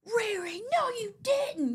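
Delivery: high, drawn-out squeal of disbelief; granddaughter squealing. Retold or imitated. Granddaughter opening new shoes, squealing with excitement. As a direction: squeal it like a thrilled little girl. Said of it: "She goes".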